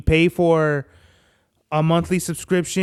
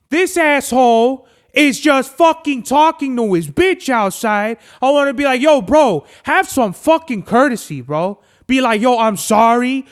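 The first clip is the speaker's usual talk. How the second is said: louder, higher, heated complaining; indignant rant; 5 semitones higher, 5 dB louder than usual. Real, not acted. Dog walker fuming at careless neighbor, indignant rant. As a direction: tear into him with punched swear words.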